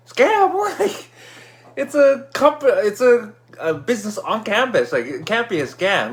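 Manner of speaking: high, indignant disbelief, easing down; incredulous protest. Retold or imitated. Nephew answering uncle's scam warning, incredulous protest. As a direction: start high and scoffing, settle into arguing.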